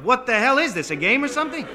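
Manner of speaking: high, loud indignant shout; exasperated yell. Acted. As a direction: yell it as a fed-up challenge. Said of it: "the winded fireman yelled".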